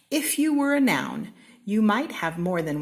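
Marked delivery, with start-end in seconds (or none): 0.1-1.3 high, loud announcing refrain; bright proclaiming refrain; excited refrain. A narrator's reading. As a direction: call it out like a title.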